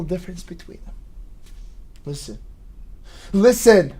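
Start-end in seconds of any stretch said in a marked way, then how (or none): 2.0-3.9 escalating to a shout. Acted. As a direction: build from a mutter to a scream. Escalating call for attention.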